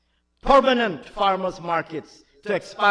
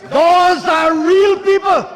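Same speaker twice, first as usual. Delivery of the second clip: louder and higher fiery oratory, hammering each charge; impassioned parliamentary attack; 7 semitones higher, 6 dB louder than usual. Acted. Minister attacks the opposition across the house, loud and fiery. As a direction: rail at the benches opposite with rising force.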